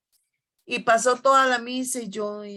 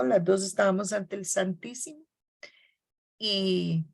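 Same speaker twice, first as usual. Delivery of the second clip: ordinary talk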